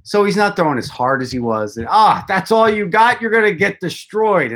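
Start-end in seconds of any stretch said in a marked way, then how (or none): 1.9-4.5 mock taunting heckler, pitched higher; heckling fan. Red Sox fans taunting a visiting pitcher, light mock jeer. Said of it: "I can't do a Boston accent".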